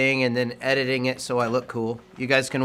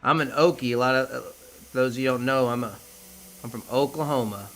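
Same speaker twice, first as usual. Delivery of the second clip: Okie accent; 5 dB quieter than usual. Real, not acted. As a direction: talk with a mild Okie accent. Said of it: "my Okie accent's coming out".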